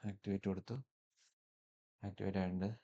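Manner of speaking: ordinary talk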